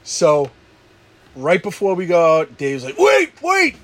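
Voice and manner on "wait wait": high excited shout imitating Dave; retold shout. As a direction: yell wait like a pushy friend. Dave urging another shot.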